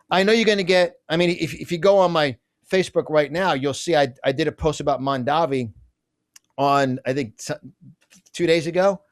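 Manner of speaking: ordinary talk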